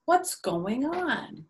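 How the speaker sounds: a hint of a worried neighbor; puzzled question. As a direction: wonder aloud what's happening, drawn out. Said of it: "And she says".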